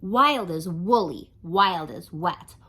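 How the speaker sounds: lively singsong rhyme reading; reading to children. A narrator's reading. Storyteller reading a rhyming book to kids, lively. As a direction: read the rhymes to the kids with bounce.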